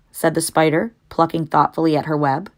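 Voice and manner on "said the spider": ordinary delivery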